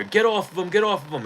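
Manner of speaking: cops yelling. Retold or imitated. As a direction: bark it like a cop breaking up a fight.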